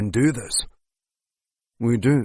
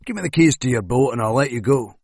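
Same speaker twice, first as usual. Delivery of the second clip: usual speaking voice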